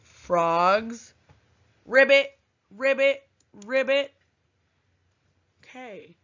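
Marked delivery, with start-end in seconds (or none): 1.8-4.1 frog croak. Shown as a demonstration. Teacher imitates frog sound.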